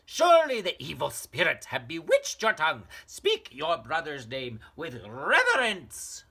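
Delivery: very high, loud, impatient mother; scolding mother. Acted. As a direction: snap at a tiresome child.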